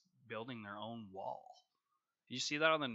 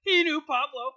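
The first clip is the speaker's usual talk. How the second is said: excited tease; 17 dB louder, slower than usual. Real, not acted. Joking cartel accusation.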